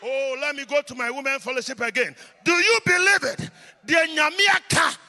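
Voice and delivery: loud, high, chanting sermon climax; preaching at full force. Acted. Preacher calling on women and choir, preaching at full force. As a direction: rouse the church into a fervent blessing.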